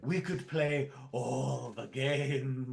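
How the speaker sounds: slow put-on romantic voice; mock dreamy. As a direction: say it like a swooning lover.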